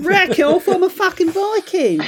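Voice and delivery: very high, hammed-up Aussie voice; Nicole Kidman. Mocking the star as a Viking, exaggerated Australian accent. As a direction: send up an Australian actress, pitched right up. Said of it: "That's what she says".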